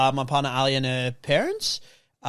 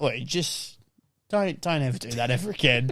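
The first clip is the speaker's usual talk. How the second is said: softer, firmly stern tired warning; 3 semitones lower, 5 dB quieter than usual. Retold or imitated. Driver telling his partner never to scream at animals, quietly stern. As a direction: tell her off sternly but low. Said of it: "It was pretty stern as well".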